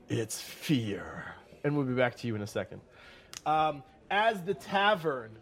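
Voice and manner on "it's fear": low, breathy whispered menace; menacing whisper. Acted. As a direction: whisper it like a threat. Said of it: "do you smell that".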